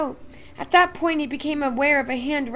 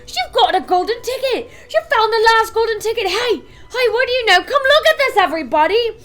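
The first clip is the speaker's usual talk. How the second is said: much higher and louder, yelling to everyone; excited shouting; 9 semitones higher, 10 dB louder than usual. A narrator's reading. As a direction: call the whole shop over.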